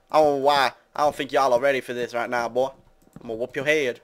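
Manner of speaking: playful mock-American accent; American guy impression. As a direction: put on a loud American twang. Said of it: "that was my impression of an American guy".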